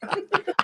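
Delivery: high, soft short laugh; brief high chuckle at own act. Real, not acted. Debater amused by his own act, high soft laugh.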